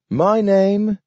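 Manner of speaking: high, talking dog; a dog speaking in a high voice. A narrator's reading. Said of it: "he said".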